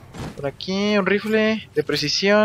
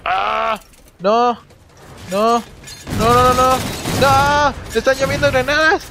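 high, loud panicked shouts; panicked yelling; 8 semitones higher, 3 dB louder, slower than usual. Real, not acted. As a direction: yell in real panic. Grenades raining on player.

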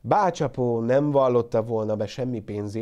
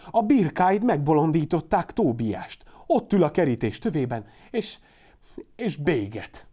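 excited telling; 5 semitones higher, 5 dB louder than usual. A narrator's reading. Excited report to neighbour.

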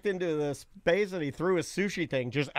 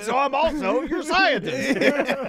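high, slow fatherly proclamation; mock dad; 7 semitones higher, much slower than usual. Dad claiming to be son's scientist, hammed-up pronouncement. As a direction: imitate a father declaring his authority. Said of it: "My dad's like".

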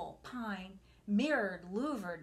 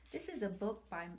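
whisper; 4 dB quieter, faster than usual. Real, not acted. Whispered aside before reading.

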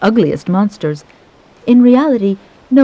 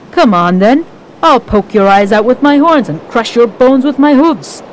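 louder, higher fierce goat challenge; big goat challenges the troll; defiant big goat; 5 semitones higher, 5 dB louder than usual. Acted. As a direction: dare the troll to come at you. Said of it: "Barbichette the Big".

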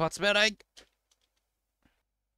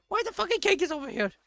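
very high, fast squealed question; high-pitched rapid outburst; exasperated squeal; faster than usual. Real, not acted. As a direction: squeal it in disbelief.

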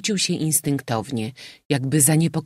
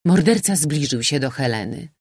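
usual speaking voice

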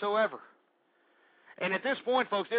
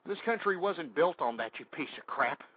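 quiet contempt; 5 semitones lower, 6 dB quieter than usual. Acted. Drops to a low insult.

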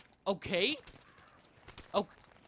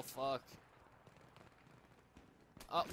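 ordinary delivery

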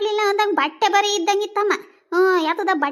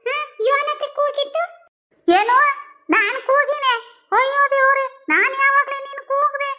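put-on higher, brighter young woman's voice, puzzled; young daughter-in-law voice; 6 semitones higher than usual. Daughter-in-law rushing out to her deaf mother-in-law, higher, puzzled voice. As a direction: play the bustling young bride asking what the fuss was.

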